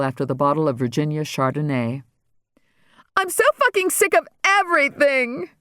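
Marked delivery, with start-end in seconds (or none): 3.1-5.5 bellowing. Acted. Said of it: "Regina bellowed".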